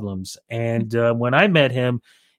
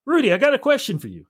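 imitating [name]; 9 semitones higher, faster than usual. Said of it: "I'll do the imitation of it".

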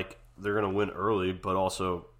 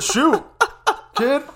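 much higher, much louder put-on old man; old wrestling host; 12 dB louder than usual. Imitating a wrestling legend grilling a guest, hammed up. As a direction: ham up a cranky old interviewer.

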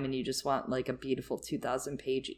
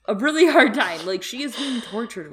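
emphatic; 7 semitones higher, 8 dB louder than usual. Real, not acted. Echoing co-host's laughing remark.

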